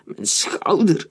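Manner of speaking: slow, drawn-out cry of pain; understated yell, stretched on the hurt. A narrator's reading. Joseph is hit in the shoulder, restrained pained cry. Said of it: "schreeuwde Joseph".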